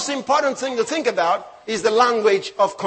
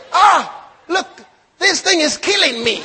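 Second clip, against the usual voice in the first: stylish complaint; 4 semitones higher than usual. Retold or imitated. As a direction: play up the agony, imitating a complainer. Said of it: "they put some style into it".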